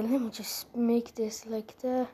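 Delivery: voiceless whisper under her breath. Real, not acted. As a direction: whisper it to yourself. Muttering to self while clicking.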